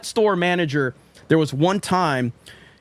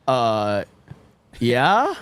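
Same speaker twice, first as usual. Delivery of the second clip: low "uh", then a tentative rising answer; halting, questioning mock-nervous answer; scared hesitation; 6 semitones lower than usual. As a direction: imitate a wary, unsure reply. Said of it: "I'm scared to death".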